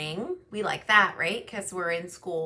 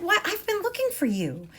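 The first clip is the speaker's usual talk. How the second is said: high, thin put-on old man voice; old man voiced delighted to find Ganesha; 11 semitones higher than usual. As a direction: play the old poet surprised. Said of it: "the old man said".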